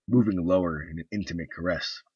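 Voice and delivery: ordinary delivery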